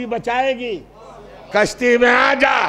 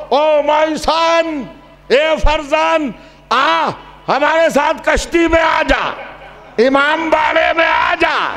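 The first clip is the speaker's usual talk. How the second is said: slow, loud pleading call; 4 semitones higher, 4 dB louder than usual. Acted. Noah calls his son from the ark, loud and pleading. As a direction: call your son across the water. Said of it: "نوح نے زور سے پکارا".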